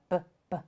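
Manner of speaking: "b, b" as two short buh sounds; "b, b" as bare starting sounds, not the letter name; isolated letter sound. Shown as a demonstration. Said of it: "Broccoli starts with the b".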